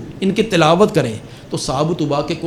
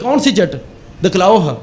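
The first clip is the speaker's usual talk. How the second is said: retold angry demand; 3 semitones higher than usual. Officer demands to see the note, mock indignant. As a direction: imitate a boss challenging his men, pitch raised. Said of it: "بڑے غصے سے کہنے لگا".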